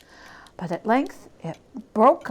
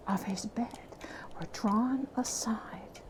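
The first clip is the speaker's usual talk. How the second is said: hushed whisper, fading away; suspenseful ghost story whisper; 10 dB quieter than usual. A narrator's reading. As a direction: let it fall to a breath.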